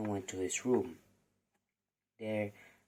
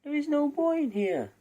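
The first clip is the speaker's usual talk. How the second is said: much higher, louder wife voice; giant's wife; 6 dB louder than usual. Acted. The wife tells the giant no boy is here, high insistent voice. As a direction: cover for the boy in a high sing-song. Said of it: "The giant's wife answered".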